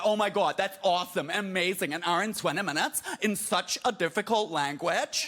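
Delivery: gushing mock American amazement; American accent. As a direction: marvel like an over-impressed American fan. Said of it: "That sounded really American".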